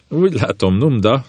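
mildly teasing, lifted in pitch; amused, laughing remark. A narrator's reading. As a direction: say it with a hint of laughter.

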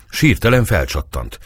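usual speaking voice

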